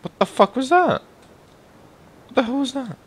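high-pitched startled question; startled outcry. Real, not acted. Gamer startled by something in the game, high and sharp. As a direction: jolt of surprise.

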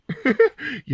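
amused laugh. Acted. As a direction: laugh at the truth dawning. Son laughs again. (சிரிப்பு வெடிப்பு)

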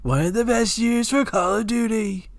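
riff like a rally speech; Trump impression. Friend joining the Trump bit, Trump voice.

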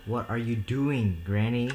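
usual speaking voice

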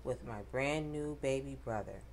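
ordinary delivery, nothing marked

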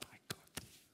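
whispered, relaxed movie line; cool whisper. Shown as a demonstration. Movie remake of the storm scene, a cool whisper. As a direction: breathe it like an action hero. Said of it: "it'd be good to be like".

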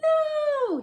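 high, loud protest; children's yell. A narrator's reading. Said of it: "yelled the children".

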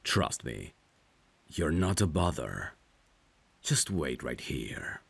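usual speaking voice

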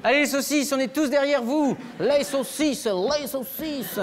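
rally behind the sausages; mock cheering. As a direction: fan chant for sausages, stretched at the end.

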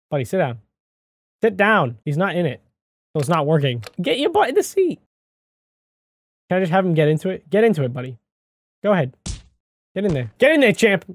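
usual speaking voice

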